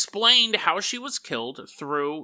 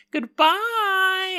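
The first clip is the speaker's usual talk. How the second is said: high goodbye; 14 semitones higher than usual. Acted. Teresa waving goodbye to friend.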